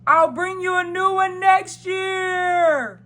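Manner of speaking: slow, high calling shout; Santa Claus. A narrator's reading. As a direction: holler it long, as if from a departing sleigh. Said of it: "Santa called".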